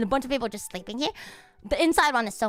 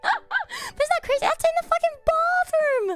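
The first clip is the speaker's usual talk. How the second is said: high, laughing exclamation; laughing disbelief. Real, not acted. Marvelling at the fancy bathroom, laughing. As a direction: squeal it in amazed laughter.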